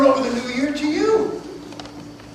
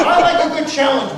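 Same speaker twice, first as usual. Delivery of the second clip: laughing boast; 7 dB louder than usual. Acted. Tiger laughing with rabbit.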